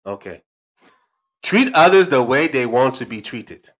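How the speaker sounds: put-on loud, emphatic African-accented proverb. Dad reveals his own quote, African accent. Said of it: "African accent".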